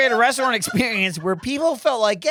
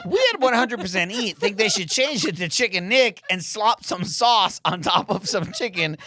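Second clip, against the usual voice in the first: usual speaking voice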